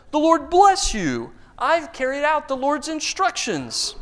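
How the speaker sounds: high, slow, falsely pious voice; smug Saul. Acted. Saul greeting Samuel, high self-righteous voice. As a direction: play Saul as an innocent, self-satisfied king. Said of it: "Saul said".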